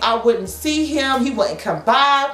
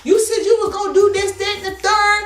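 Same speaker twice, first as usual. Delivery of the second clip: put-on other girl's voice; 8 semitones higher than usual. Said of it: "she was like".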